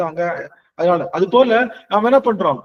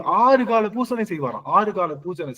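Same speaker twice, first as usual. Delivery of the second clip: normal talking voice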